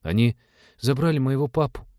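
put-on high-pitched child voice, close to tears; frightened little boy. A narrator's reading. Рики fears for his captured dad, upset high child voice. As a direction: play an upset small boy. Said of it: "Губы Рики задрожали".

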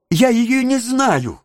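put-on high, pleading orphan boy; terrible alarm. A narrator's reading. Oliver denying family to onlookers, alarmed protest. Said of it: "воскликнул Оливер в страшной тревоге".

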